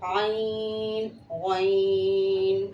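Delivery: आइन and गौइन held very long; slow letter recitation. Shown as a demonstration. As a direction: stretch both names out like a teacher. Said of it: "गौइन है". (धीमे खींचकर आइन, गौइन)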